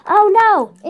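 high, loud oh no; alarmed cry. Acted. Lego figure watches the hand attack, alarmed cry. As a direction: gasp it out in alarm.

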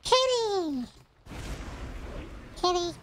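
high-pitched call. Real, not acted. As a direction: shout for the kitty way up high. Calling the cat off the desk.

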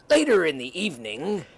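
low, even storyteller read; story narration. A narrator's reading. Narrator between character lines.

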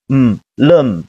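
"嗯" as a bare m, then "lem" slowly blended; mute m sound. Shown as a demonstration. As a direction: hum the m, then join it into "lem". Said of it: "所以说要发哑巴音".

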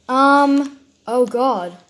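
startled loud yelp; scared horror game streamer. Real, not acted. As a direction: caught off guard by a scare.

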